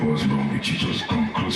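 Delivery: low menacing seduction; dark deep voice. Retold or imitated. Michael pulling him close, dark and deep. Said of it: "the voice goes dark, deep".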